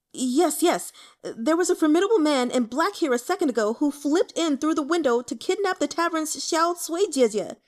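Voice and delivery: high, loud agitated tumble of words; in a rush. Acted. Injured youth blurting out the kidnapping in a rush. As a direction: rush it out all in one breath. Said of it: "spoke in a rush".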